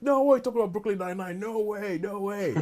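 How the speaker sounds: high, scoffing disbelief; mock the doubters' high-pitched scoff; incredulous no way. Friend scoffing at the show pick in high disbelief. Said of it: "And they're like".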